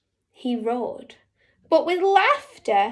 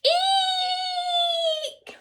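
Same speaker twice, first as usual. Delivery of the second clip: a tiny frightened shriek; high squealing eek. A narrator's reading. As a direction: shriek like a scared mouse. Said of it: "let out a".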